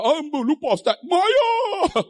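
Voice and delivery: high wail climbing into a cry; hammed-up tearful outburst; imitated crying. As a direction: blurt it high, then wail the last words. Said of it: "Menangis dia".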